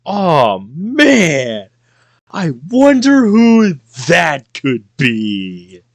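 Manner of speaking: sarcastic. Acted. Obvious guess, mock wonder.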